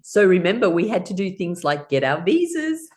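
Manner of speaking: ordinary talk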